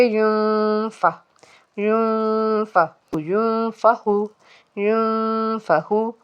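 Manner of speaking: यूं held long as a nasal hum before फ़; slow यूं, humming, no full n; nasal ikhfa. Shown as a demonstration. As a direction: hide the n of यूं in the nose, then release फ़ा or फ़हू. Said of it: "आवाज़ को नाक में छुपाया है".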